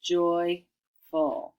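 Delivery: "Joyful" in two separate syllables, joy, full; root plus ending. Shown as a demonstration. Teacher adding the ending, "Joyful" slow and separated. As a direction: pause slightly between root and ending of "Joyful". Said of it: "we're gonna put the ending full on there".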